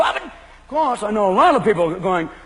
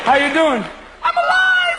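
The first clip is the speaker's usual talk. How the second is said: much higher, squealing joy; ecstatic runner; 3 dB louder, slower than usual. Acted. Marathon runner at the finish, ecstatic squeal. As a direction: burst out in runner's high.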